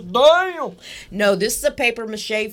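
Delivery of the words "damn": high, loud, joking "damn"; hammed-up astonished shout; echoed mock amazement. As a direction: echo the shocked blurt playfully.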